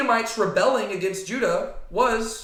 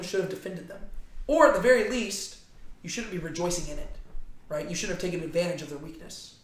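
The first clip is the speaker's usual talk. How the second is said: usual speaking voice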